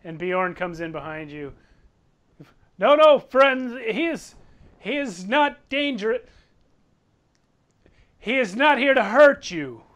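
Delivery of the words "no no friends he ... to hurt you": high, loud plea to a crowd; urgent appeal. Acted. Bjorn vouching for Throck to alarmed townsfolk, urgent loud appeal. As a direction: call out to calm them down.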